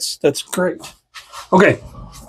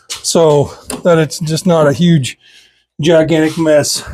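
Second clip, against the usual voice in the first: ordinary delivery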